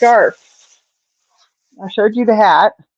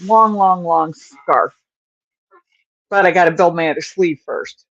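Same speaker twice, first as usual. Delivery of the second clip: usual speaking voice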